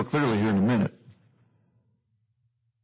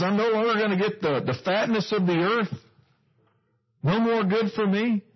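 lamenting Esau; 8 semitones higher than usual. Acted. Esau laments lost fatness of earth.

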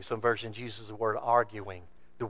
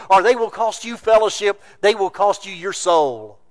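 rising, louder pulpit preaching; preaching at full force; 7 semitones higher, 15 dB louder than usual. Acted. Preacher warning the congregation, in full pulpit force. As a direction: drive it home to the congregation.

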